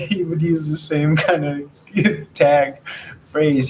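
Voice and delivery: usual speaking voice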